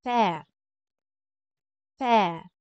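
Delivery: "père" with an open è as in "bed"; French pronunciation. Shown as a demonstration. Teacher giving the French word for father, open è. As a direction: model "père" with a wide vowel, falling. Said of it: "word na father, which is".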